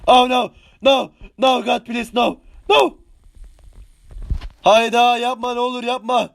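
panicked pleas. Real, not acted. Panicking as enemy attacks.

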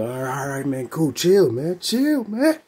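a coach hollering at a fighter to calm down; boxing coach. Retold or imitated. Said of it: "If your boxing coach tell you to chill".

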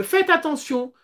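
ordinary delivery

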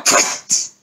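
voiceless puff of escape; breath sound of something darting off; imitated whoosh. A narrator's reading. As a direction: puff out a quick "pfft". Imitates rabbit darting away.

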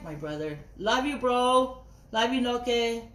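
ordinary talk, nothing marked

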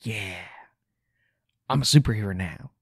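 mock-cool self-satisfied line; lightly hammed smug heroine; smug superhero quote. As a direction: say it like a smirking hero showing off. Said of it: "with this cool smirk on her face".

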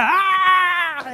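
high, loud battle shout; war cry. Retold or imitated. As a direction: let out the army's cry. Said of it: "Kasi habang nag-shout sila".

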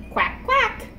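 high, bouncy duckling quack; duck quack. Pretend. Duckling sound in picture book.

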